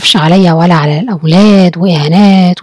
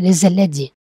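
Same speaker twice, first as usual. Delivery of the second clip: normal talking voice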